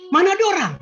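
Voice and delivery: high, loud retold outburst; startled cry. Grandfather imitating the child waking with a start, loud startled call. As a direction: call out as if jolted awake. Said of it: "dia bangun kaget".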